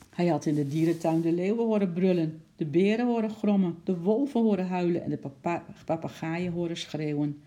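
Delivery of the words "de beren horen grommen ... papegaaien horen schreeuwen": usual speaking voice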